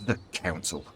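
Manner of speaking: scornful, held-down echo; spat with disgust. A narrator's reading. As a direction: spit the name out like a foul taste. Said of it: "spat the word from her mouth".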